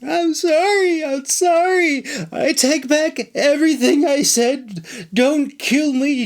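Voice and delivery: very high, whimpering apology; sobbing high plea; pleading. Acted. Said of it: "Traja pleaded".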